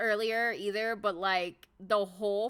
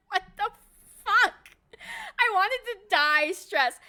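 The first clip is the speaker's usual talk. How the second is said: rising into a louder shocked yelp; shocked disbelief; 10 semitones higher, 7 dB louder than usual. Retold or imitated. Sanji called her beautiful, shocked yelp. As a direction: blurt it out, stunned. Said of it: "I was like".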